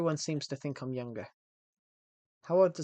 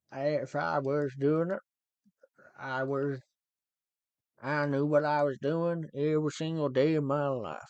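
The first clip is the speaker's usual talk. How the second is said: [name]; 4 dB louder than usual. Acted. Streamer clowning for viewers, Elvis impersonation. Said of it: "Sound like [name]".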